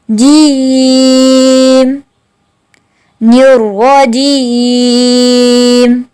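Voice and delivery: जीम drawn very long and slow; slow drilled recitation. Shown as a demonstration. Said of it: "बिल्कुल same वही जी है". (जीम लंबा खींचकर)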